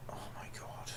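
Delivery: soft, voiceless, drained whisper; exhausted gamer whispering in disbelief; defeated whisper. Real, not acted. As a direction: breathe it out like you've given up. Whispered despair after outburst.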